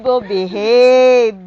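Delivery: loud, long drawn-out word. Real, not acted. Adult telling baby to behave, drawn-out baby talk.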